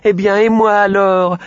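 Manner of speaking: voix aiguë et forte de petit cochon; cri du petit cochon. A narrator's reading. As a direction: crier de toutes ses forces, essoufflé. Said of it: "en criant de toutes ses forces". (voix de cochon qui crie, aiguë et forte)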